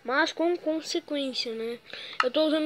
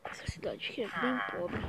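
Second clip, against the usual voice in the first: whisper; 5 semitones lower, 8 dB quieter than usual. Acted. Walking through poor town, aside.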